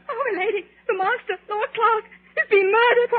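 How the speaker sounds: hysterical fear. Acted. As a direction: break down as the horror sinks in. Maid discovering master murdered.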